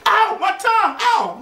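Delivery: high, hammed-up yelp of pain; pretend pained yelp. Joker imagining his tongue whacked off, pretend pained yelp.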